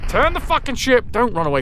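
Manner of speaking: loud, high frustrated shout; frustrated yell. Real, not acted. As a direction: yell at the fleeing ship. Yelling at fleeing enemy ship.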